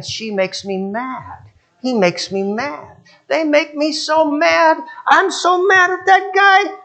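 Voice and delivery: imitating a petty complainer building up; mock angry complaint. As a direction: whine it out, getting madder each line. Mocking complainer building anger.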